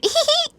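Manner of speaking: a high little mock giggle. Retelling how she giggles at his confession, high giggle. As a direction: giggle like a flustered girl. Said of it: "And she's giggling".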